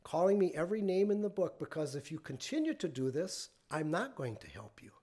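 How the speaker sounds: soft, controlled, measured firmness; imitating a composed manager calming an angry customer. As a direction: set a limit quietly without heat. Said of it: "he said very calmly".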